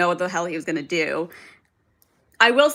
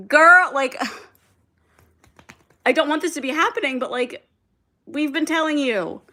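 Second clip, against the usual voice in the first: exasperated told-you-so; 6 semitones higher than usual. Retold or imitated. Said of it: "I'm like".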